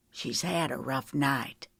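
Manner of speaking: husband quietly telling his wife, soft and slow; whispered. Acted. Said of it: "he whispered".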